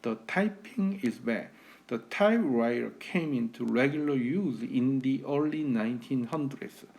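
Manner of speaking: very slow native-like American English; American accent. Shown as a demonstration. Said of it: "거의 미국인 원어민 발음 흉내는 거예요". (느린 미국식 원어민 발음)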